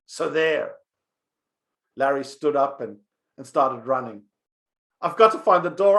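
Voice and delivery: put-on lively storyteller reading a children's tale; story narration. A narrator's reading. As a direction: tell it like a bedtime story for kids. Rabbi reads a story aloud.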